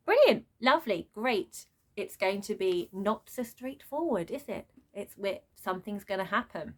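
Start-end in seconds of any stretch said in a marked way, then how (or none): 0.1-1.7 gasped, high sarcastic "brilliant". Real, not acted. Vlogger mocking the plot twist with sarcastic delight. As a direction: gasp then fake delight.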